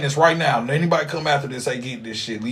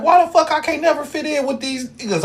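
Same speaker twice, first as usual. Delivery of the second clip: way higher pitched, frustrated whine; exasperated self-quote; 12 semitones higher than usual. Retold or imitated. Retelling how he used to complain about not fitting in, high and exasperated. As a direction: replay his younger self's outrage. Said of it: "I'm like".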